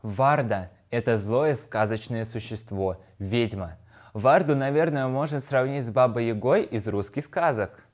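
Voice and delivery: normal talking voice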